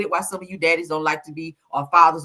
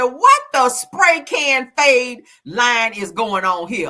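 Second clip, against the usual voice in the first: loud, slow, higher mock outrage; mock outraged quote; 7 semitones higher, 7 dB louder, much slower than usual. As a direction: stretch each word in loud disbelief. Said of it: "They said".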